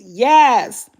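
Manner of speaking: ordinary delivery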